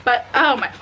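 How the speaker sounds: usual speaking voice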